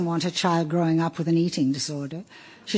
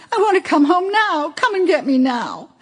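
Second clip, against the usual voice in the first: put-on high, insistent little boy begging; a homesick child demanding to be fetched now; young son pleading, much higher and louder; whiny child's plea; 9 semitones higher, 5 dB louder than usual. A narrator's reading. Child begging to come home.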